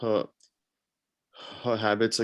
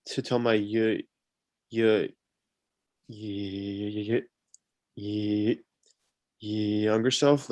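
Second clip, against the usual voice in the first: repeated blocks on y, real stutter; stuck on the first sound; slower than usual. Real, not acted. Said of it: "a covert stutterer".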